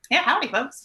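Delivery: lightly hammed-up own greeting; self impression. Shown as a demonstration. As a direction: sell the howdy with a bright lilt. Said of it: "I do say howdy".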